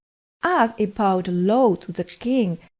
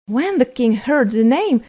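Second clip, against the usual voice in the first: ordinary delivery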